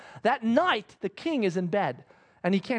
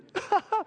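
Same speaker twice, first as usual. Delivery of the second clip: amused laugh; 11 semitones higher than usual. Real, not acted. Speaker laughing at Haman.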